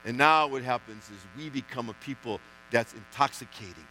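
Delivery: low, hushed, intimate preaching; a quiet tender invitation; soft after the peak. Acted. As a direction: bring them in close, quietly. Sudden soft drop after climax.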